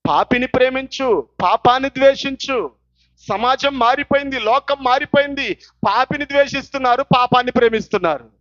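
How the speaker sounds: loud, emphatic sermon proclamation; full-force preaching. Real, not acted. Preacher decrying a society that loves sin, full-force preaching. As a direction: hammer each phrase out to the congregation.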